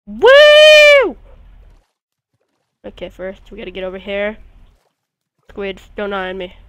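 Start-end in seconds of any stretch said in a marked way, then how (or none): 0.1-1.2 very high, loud whoop; gleeful whoop. Pretend. Imagining flying a magic carpet, very high loud whoop. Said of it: "I'm like".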